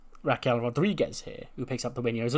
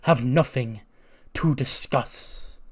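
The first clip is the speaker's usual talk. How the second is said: very slow, cold brush-off; mock-icy dismissal, very slow; cold refusal; 3 semitones higher, 6 dB louder, much slower than usual. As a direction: drag every word out flatly to shut him down. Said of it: "And of course".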